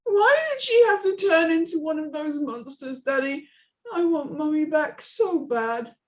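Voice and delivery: put-on high child's voice, worried; a small girl frowning at her father; little girl. Amy's voice.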